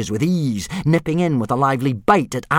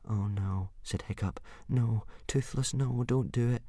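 much softer, worried pleading; anxious whisper-soft plea; 12 dB quieter than usual. Acted. Hiccup quietly begging Toothless not to taunt, soft. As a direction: beg him quietly to stop.

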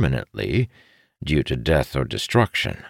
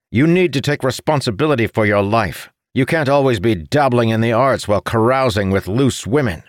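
higher, forceful scolding; harsh; 8 semitones higher, 4 dB louder than usual. A narrator's reading. Brother scolds his spoiled sibling, harsh. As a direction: dress your brother down harshly. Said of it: "Arentil said harshly".

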